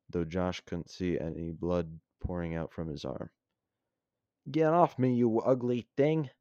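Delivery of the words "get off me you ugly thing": higher, louder furious yell. Acted. Josh pinned by the creature yells at it, furious yell. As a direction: yell it while fighting it off. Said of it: "yelled Josh".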